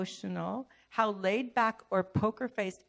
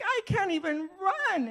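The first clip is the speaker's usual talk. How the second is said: high, whiny mock sobbing; mock tearful wail; 11 semitones higher, 4 dB louder than usual. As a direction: exaggerate the self-pity for laughs. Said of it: "I'm just crying".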